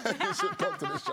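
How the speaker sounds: laughing high invitation. Real, not acted. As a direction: laugh it out, playfully.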